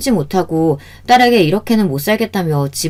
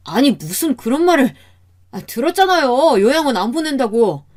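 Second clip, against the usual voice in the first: higher-pitched, aggrieved protest; exasperated denial; 6 semitones higher than usual. Acted. Son-in-law denying nursing home plan, exasperated denial. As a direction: push back in frustration.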